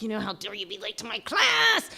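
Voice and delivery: a hint of stern mentor's scolding; mock angry glare. Mentor glaring at her late student, mild mock scolding. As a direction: voice the teacher's silent fury. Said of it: "shoots daggers at me".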